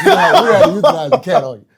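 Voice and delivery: laughing mock nice. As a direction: giggle while breaking the bad news sweetly. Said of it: "But she did it real nice".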